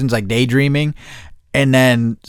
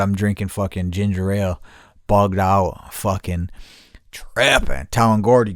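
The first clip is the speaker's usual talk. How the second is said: usual speaking voice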